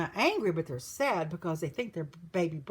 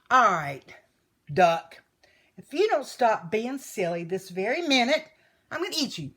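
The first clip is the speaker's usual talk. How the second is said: loud wolf ultimatum; hurt, angry threat; 8 dB louder than usual. A narrator's reading. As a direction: start with loud single words, then rise into a threat. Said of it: "feelings were horribly, horribly hurt".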